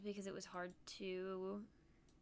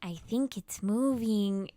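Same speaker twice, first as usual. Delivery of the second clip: slow, louder, dead serious claim; mock earnest; 9 dB louder, slower than usual. Friend staring at Venus, mimicked slow and serious. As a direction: say it like a naive discovery. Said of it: "And she went".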